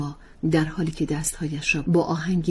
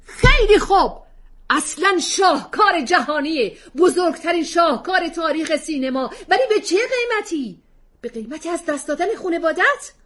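much higher, louder roar fading; shout; 13 semitones higher, 9 dB louder than usual. Acted. Husband shouting at wife over her film, yelling. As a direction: yell it after banging the fist. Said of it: "فریاد کشید".

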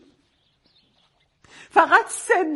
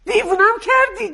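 higher, louder exasperated cry; angry outburst; 5 semitones higher, 4 dB louder than usual. Acted. Mother blowing up at her daughter, exasperated shout. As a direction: throw it at her, fed up.

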